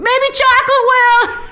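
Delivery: very high, playful squeal that breaks into laughter; high-pitched squeal. Real, not acted. Recapper consoling a sad character with dessert, in a high playful squeal.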